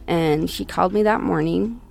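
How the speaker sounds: normal talking voice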